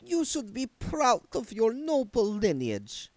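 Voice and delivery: high, slow, lofty reproach; haughty. A narrator's reading. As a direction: look down on him as you speak. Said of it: "said Doreen haughtily".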